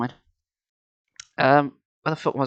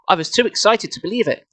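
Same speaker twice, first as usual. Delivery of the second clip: usual speaking voice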